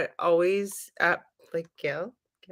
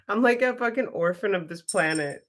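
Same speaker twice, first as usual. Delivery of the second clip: ordinary delivery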